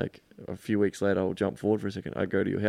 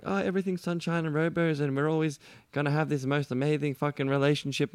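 higher, sing-song fake positivity; mock cheerful gushing; 7 semitones higher than usual. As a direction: gush it like everything's perfect. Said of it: "instead of going".

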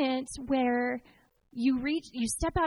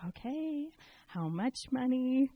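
wary husband; slower than usual. Retold or imitated. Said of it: "He's like".

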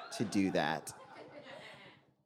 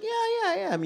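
much higher, sing-song fake yes; mock agreement; 4 dB quieter than usual. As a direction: chirp it like a too-eager yes. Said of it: "And I was like".

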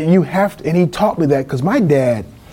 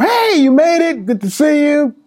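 very high, louder imitation of mother; gushing greeting; 4 dB louder than usual. Mother welcoming son into the house, gushing. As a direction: greet him in a high, delighted mock voice.

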